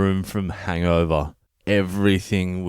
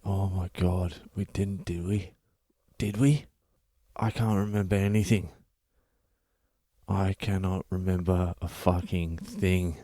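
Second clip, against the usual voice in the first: hushed, much softer mock dread; dawning horror; 7 dB quieter than usual. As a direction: shrink into a small, stunned voice. Dawning horror at last night.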